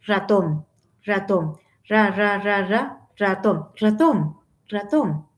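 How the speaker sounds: "ratón" with a short, soft r; untrilled, easy r in "ratón". Shown as a demonstration. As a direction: keep the r of "ratón" gentle, barely rolled. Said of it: "estoy haciendo una pronunciación suave".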